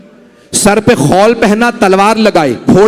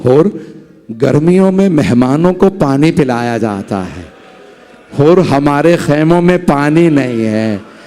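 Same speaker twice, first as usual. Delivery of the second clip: gentle smiling reply; 8 semitones lower than usual. Acted. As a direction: answer him kindly with a sad smile. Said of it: "मौला ने मुस्कुरा के कहा". (धीमी, नरम, मुस्कुराती आवाज़)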